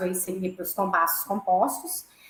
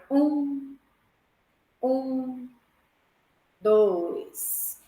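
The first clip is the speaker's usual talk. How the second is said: counting beats; much slower than usual. Shown as a demonstration. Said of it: "se eu tô fazendo a divisão binária".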